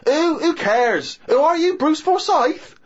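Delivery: high, loud scornful put-down; shrill mock disdain; mocking girlfriend. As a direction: sneer it like a dismissive girlfriend. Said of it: "And she went".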